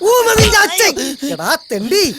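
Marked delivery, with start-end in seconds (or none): 0.1-0.9 angry outburst. Acted. Angry retort after insult.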